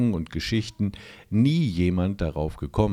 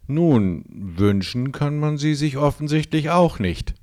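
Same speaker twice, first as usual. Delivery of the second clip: sarcastic; 7 semitones higher, 4 dB louder than usual. A narrator's reading. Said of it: "entgegnete Udo sarkastisch".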